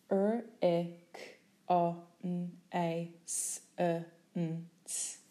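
very slowly sound out "R-E-C-O-N-A-S-S-E-N-C-E" one sound at a time; each sound drawn out separately; sounded out letter by letter. Shown as a demonstration.

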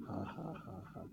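low, quiet, measured ha-ha; faith laugh. Shown as a demonstration. Preacher answering a question about money, deliberate faith laugh. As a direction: laugh off the worry on purpose. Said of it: "Laugh in faith".

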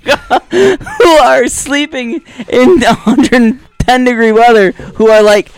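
high laughing, words breaking up. Real, not acted. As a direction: laugh through the line. Laughing through joking rant.

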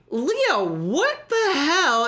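mock disbelief. Canadian soldiers seeing Leo walk in, mock shock. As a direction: act stunned, half laughing.

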